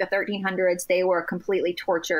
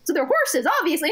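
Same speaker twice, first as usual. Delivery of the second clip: higher-pitched, matter-of-fact retort; lightly voiced practical mom; 7 semitones higher, faster than usual. Mom reassuring her upset child about pets in heaven, matter-of-fact. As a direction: imitate a mom brushing off nonsense. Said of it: "this, like, very common sense, practical".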